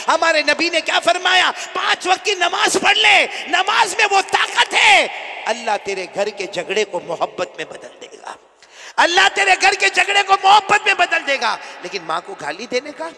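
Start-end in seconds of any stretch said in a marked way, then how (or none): 0.1-5.1 high, loud preaching shout; fiery preaching. Acted. Preacher proclaiming loudly to the congregation. 9.0-11.5 high and loud, मोहब्बत stressed; proclaimed shout. Acted. Preacher shouting the promise to the congregation.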